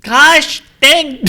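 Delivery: very high laughing hokey exclaim; goofy cartoon voice. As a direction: squeak it like a cartoon neighbor.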